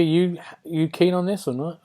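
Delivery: normal talking voice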